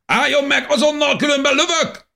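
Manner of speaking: high, loud soldier shouting a warning; armed soldier shouting a last warning; shouted threat. A narrator's reading. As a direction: yell it down the tunnel, ready to fire.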